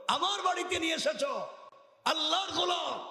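high, sustained preacher's cry; voicing a quoted outcry, pitched far up; retold exclamation. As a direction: ring it out high on held notes. Acted indignant cry of the Prophet.

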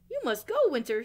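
high, loud shout. Acted. Owl ordering Winter out of his house, shouting. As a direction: shout him out the door. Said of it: "shouted Owl".

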